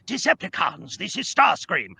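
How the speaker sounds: put-on restrained villain with a light British accent; Starscream. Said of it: "We just want a light British accent".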